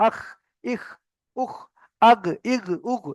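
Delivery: "আখ, ইখ, উখ, আগ, ইগ, উগ" scraped from the throat; spaced throaty syllables "আখ, ইখ, উখ, আগ, ইগ, উগ". Shown as a demonstration. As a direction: drill "আখ, ইখ, উখ" then voiced "আগ, ইগ, উগ" at the throat. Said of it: "ওখান থেকে আওয়াজটা বের হবে".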